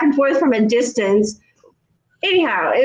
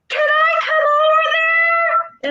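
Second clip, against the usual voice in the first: high, slow, stretched calling voice; imitating the neighbor; slower than usual. Neighbor asking to come over, high drawn-out call. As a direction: sing it out across the distance. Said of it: "she was like".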